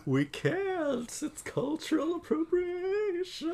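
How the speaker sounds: very high ghostly voice. Pretend. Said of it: "sounds like a ghost".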